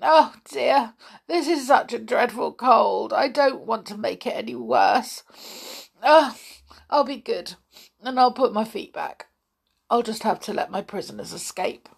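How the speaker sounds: high, plaintive little spider with a cold; a sorry, sniffly creature pleading to be good. Acted. Spider character speaking.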